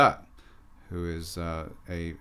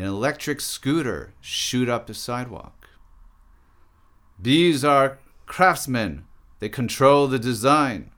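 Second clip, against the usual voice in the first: measured, declaimed poem performance; poem reading; 4 semitones higher, 8 dB louder than usual. Acted. Artist performs a poem about philosophers, declaimed. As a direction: recite it with deliberate phrasing and pauses. Reads own poem aloud.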